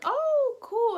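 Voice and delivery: high-pitched, drawn-out polite interest; an exaggerated, high "oh, cool" reaction; mock impressed. Woman reacting to his hometown, mock impressed.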